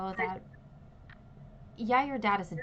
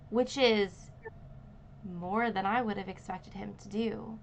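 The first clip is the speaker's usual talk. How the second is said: normal talking voice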